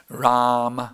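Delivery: "Ram" with a subtle final vowel; demonstrating Ram. Shown as a demonstration. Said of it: "let me, I'll say".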